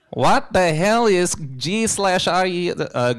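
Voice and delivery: loud, high hammed-up Mexican accent. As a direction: ham up a Mexican accent, laughing through it. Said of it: "In a Mexican accent".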